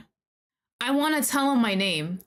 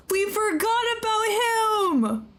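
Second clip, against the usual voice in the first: crying wail; 11 semitones higher, slower than usual. Real, not acted. Realising she abandoned him.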